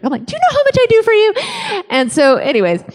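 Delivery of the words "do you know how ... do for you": very high, fast mock tantrum; cranky, like a jerk. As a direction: squeal it indignantly in one rush. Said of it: "being such a jerk".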